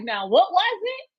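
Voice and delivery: high mocking teasing of trash talkers; taunting. Teasing rival fans on the phone, high and mocking. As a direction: rub it in, sing-song and high. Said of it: "I was on the phone like".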